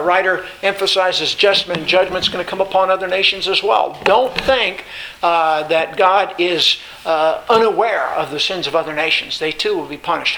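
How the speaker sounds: ordinary talk, nothing marked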